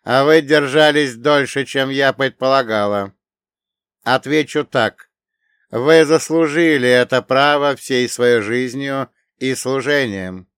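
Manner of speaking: solemn, loud, measured machine voice. Acted. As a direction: speak with ceremonial gravity. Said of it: "Голос звучал торжественно".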